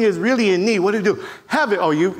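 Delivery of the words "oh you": normal talking voice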